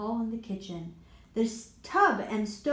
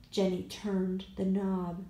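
ordinary delivery

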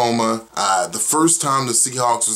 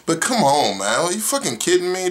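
ordinary talk

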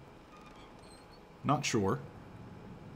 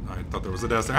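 normal talking voice

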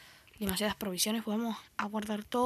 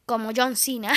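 ordinary talk

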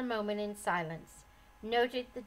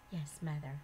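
softer, lower meek yes; gentle; 7 semitones lower, 6 dB quieter than usual. A narrator's reading. Daughter-in-law gently answering her mother, soft. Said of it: "said Forgiveness gently".

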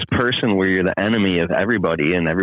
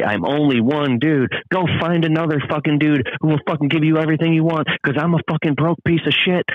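breakdown yell; 8 semitones higher than usual. Retold or imitated. To his wife during a breakdown, screaming. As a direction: shout it out, pushed to the edge.

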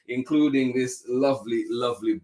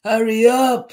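high, loud storybook shout; bus driver's shout; 12 semitones higher, 11 dB louder than usual. A narrator's reading. Bus driver rushing Tisha along, high urgent shout. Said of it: "shouted the bus driver".

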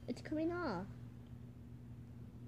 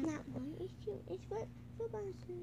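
silly voice; 5 semitones higher, 12 dB quieter than usual. Acted. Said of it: "The way you said flip bazoo".

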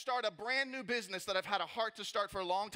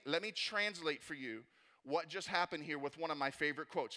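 ordinary talk, nothing marked

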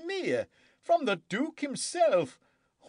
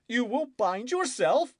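cried out; 14 semitones higher than usual. A narrator's reading. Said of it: "cried the king".

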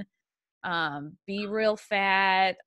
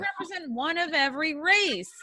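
mock woke comedy voice; 7 semitones higher than usual.

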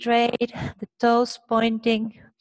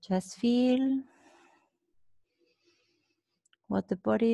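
soft, calm guided relaxation; soft spa whisper; 4 dB quieter than usual. Acted. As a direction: ease them into rest gently. Guided relaxation after exercise.

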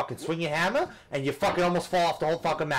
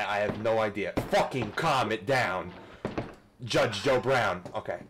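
ordinary talk

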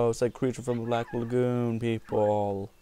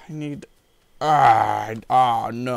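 ordinary talk, nothing marked

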